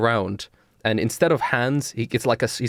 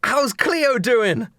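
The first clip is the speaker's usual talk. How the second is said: high-pitched, eager party-guy voice; exuberant Blake; 11 semitones higher than usual. Acted. Blake asks Hemlock how Cleo is, exuberant. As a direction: greet old pals with rowdy enthusiasm. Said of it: "but immediately Blake goes".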